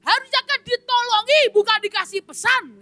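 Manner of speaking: indignant complaint. Acted. Man complains to the angel, high exasperated. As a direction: protest you wanted help not a message. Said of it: "Dia berkata".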